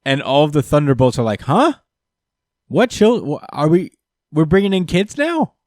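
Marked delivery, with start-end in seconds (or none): 1.4-5.5 baffled. Retold or imitated. Villains baffled by order.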